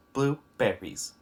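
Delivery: BLUEBERRIES slowly, beat by beat; three syllables. Shown as a demonstration. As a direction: separate blue-ber-ries into three slow beats. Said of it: "Blueberries has three syllables".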